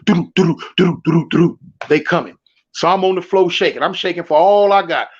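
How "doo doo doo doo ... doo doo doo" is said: low clip-clop of hooves, mouthed; galloping horse noise. Retold or imitated. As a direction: imitate horses coming, low. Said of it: "I can hear 'em, them horses".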